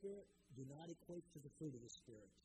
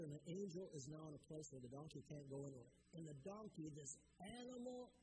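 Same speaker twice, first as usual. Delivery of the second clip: ordinary delivery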